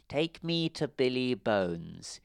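slow, menacing blind man; a threat through the teeth; fierce hiss. A narrator's reading. Blind man fiercely ordering Jim to take him. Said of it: "he hissed fiercely".

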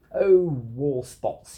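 loud, drawn-out amused exclamation; hammed-up storybook chuckle in the voice; laughing dismissal. A narrator's reading. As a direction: laugh it off grandly, slowly. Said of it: "he laughed".

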